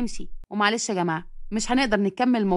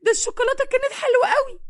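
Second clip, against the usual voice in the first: put-on shouting girl; 10 semitones higher than usual. A narrator's reading. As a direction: wail over the lost chocolate. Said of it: "وهي بتزعق وبتقول".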